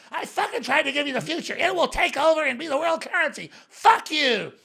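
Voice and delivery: a hammed-up furious friend; imitating Max Keiser. Friend yelling at Alex, hammed-up angry impression. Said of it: "I can't do his voice".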